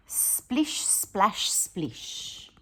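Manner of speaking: breathy whispered water sounds; whispered splish splash. Retold or imitated. Teacher whispering the sound of Rosie watering plants.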